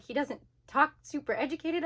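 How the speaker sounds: ordinary talk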